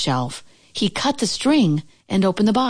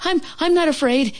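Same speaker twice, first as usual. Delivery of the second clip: high, stammering frightened Toad; a scared toad insisting he is not afraid; stammering fear; 10 semitones higher than usual. Acted. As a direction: fake bravery while shaking. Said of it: "he cried".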